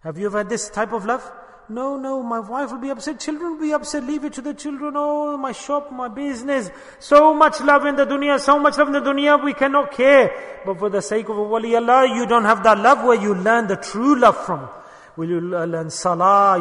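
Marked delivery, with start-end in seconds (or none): 1.7-6.7 higher, lightly whiny mocking of a worldly man; mock excuses. Preacher mimicking followers who put family first, mock whine. 7.0-14.7 louder, higher fervent preaching. Acted. Imam lamenting love of the world, fervent preaching.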